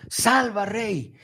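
high, pleading cry to the king; crowd's plea. Retold or imitated. People of Samaria begging their king, high pleading cry. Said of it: "Así le dijeron".

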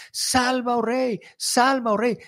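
high, pleading cry to the king; crowd's plea. Retold or imitated. People of Samaria begging their king, high pleading cry. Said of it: "el grito que vamos a escuchar".

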